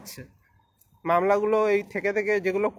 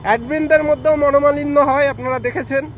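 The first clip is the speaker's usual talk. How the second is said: raised voice; 4 semitones higher, 4 dB louder than usual. Real, not acted. Urgent appeal for unity to followers.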